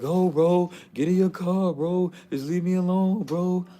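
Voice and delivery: high, loud, pleading mock girlfriend voice; imitating crying girlfriend. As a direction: play the upset girl begging him to leave. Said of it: "And she was like".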